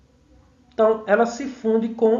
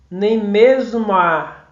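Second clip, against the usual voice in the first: slow, louder "nem mesmo a" with the vowels run together; fused-syllable scansion; 6 dB louder, much slower than usual. Shown as a demonstration. Teacher showing students how vowels fuse, slow scansion. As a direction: stretch "nem mesmo a", gliding "mo" into "a". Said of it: "então fundiu".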